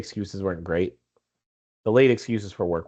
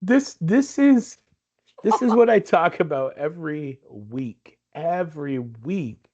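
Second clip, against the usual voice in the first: exasperated; 5 semitones higher, slower than usual. Real, not acted. Exasperated rant at lost cards.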